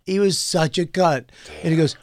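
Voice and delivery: usual speaking voice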